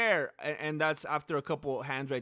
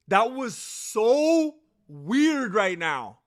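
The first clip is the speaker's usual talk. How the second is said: stunned; 4 semitones higher, 6 dB louder, much slower than usual. Real, not acted. Stunned by the ending.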